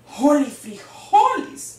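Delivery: loud, drawn-out alarmed exclamation; crying out. A narrator's reading. Skibito sees the bandit inches from his face, crying out loudly. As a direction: belt it out slowly with a jump in pitch. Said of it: "cried Skibito".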